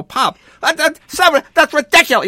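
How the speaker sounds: high, loud flustered protest; exasperated shout. Retold or imitated. Son to shouting dad in the store, loud exasperated protest. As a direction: plead loudly, sputtering. Leno argues with his dad.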